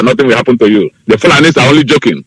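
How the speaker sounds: ordinary talk, nothing marked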